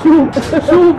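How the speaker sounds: squealing with excitement, very high; yelling look, look; excited high cry. Acted. (صرخة حماس عالية)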